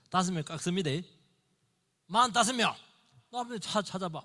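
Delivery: Abraham bargaining down the number; haggling Abraham. Retold or imitated. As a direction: haggle with God like a merchant.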